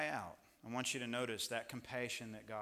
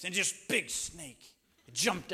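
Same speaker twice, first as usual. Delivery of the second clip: imitating his son; 6 semitones higher, 8 dB louder, slower than usual. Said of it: "He puts his arms out like this".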